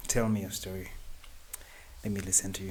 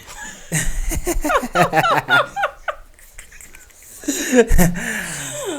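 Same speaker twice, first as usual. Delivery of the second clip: loud, high-pitched burst of laughter; hearty laugh; 14 dB louder than usual. Real, not acted. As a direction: laugh out loud in long peals. Host laughs at guest's formality.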